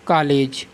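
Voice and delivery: कॉलेज said as "kaa-lej"; plain long "aa" in place of the vowel in कॉलेज; long aa mispronunciation. Shown as a demonstration. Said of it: "या तो हम उसे कॉलेज लिखते".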